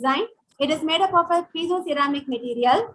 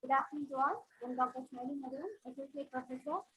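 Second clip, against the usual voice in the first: barely audible; 14 dB quieter than usual. Real, not acted. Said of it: "I'm audible now".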